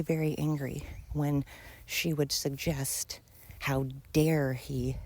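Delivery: usual speaking voice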